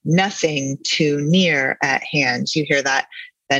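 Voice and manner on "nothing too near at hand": slow, stress on first syllables of "Nothing" and "near"; slow dactylic beat on "Nothing too near at hand". Shown as a demonstration. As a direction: punch "Noth" and "near", keep "ing too" and "at hand" unstressed. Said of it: "he emphasizes the first of three syllables".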